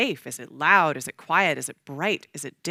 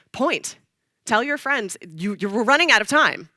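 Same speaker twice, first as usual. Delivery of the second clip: louder, high urgent command; urgent direction; 5 semitones higher, 7 dB louder than usual. Retold or imitated. Director focusing an actor's scattered energy, urgent direction. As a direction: drive it straight at them, raised and pressing.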